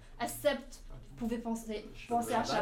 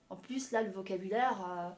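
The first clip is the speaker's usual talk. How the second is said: ordinary talk, nothing marked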